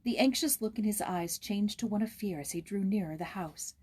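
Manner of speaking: ordinary delivery, nothing marked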